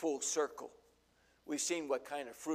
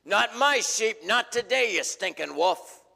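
defiant shepherd; 8 semitones higher, 14 dB louder than usual. Acted. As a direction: stand your ground and shout the wolf down. Shepherd defies wolf in parable.

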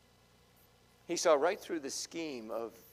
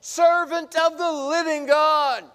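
much higher, much louder anguished cry; anguished call; 13 semitones higher, 16 dB louder, slower than usual. Acted. King calling anxiously to Daniel in the den, anguished cry. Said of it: "he called to Daniel in an anguished voice".

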